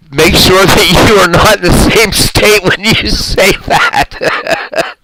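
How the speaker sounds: giddy laughing read of a comment; laughing excitedly. Real, not acted. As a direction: read the chat while still cracking up. Laughing while reading chat aloud.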